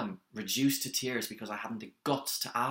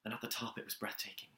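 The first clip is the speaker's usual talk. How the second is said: much softer, nearly voiceless by the end; hushed awe; 9 dB quieter, faster than usual. Acted. Recalling the top of Everest to Brian, hushed. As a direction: let the breath take over in wonder. Awed memory of the summit.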